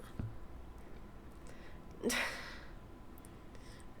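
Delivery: long, soft breathy sigh. Acted. Villain sighs at the rival, weary sigh. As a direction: let out a quiet sigh. Reacting to being wounded again.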